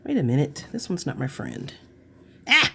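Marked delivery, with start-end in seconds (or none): 2.5-2.7 sudden sharp cry; short yelp. Real, not acted. Crafter fighting a piece that won't behave, short yelp. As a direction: let out a quick "ah!".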